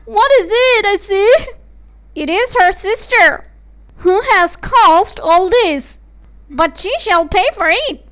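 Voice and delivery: high, loud, vengeful tirade; mother blaming the good daughter; furious mother. Acted. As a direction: threaten through rising rage. Horrified mother vows revenge.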